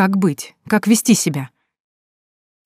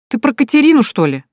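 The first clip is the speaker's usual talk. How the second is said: feigned surprise; 7 semitones higher, 5 dB louder than usual. A narrator's reading.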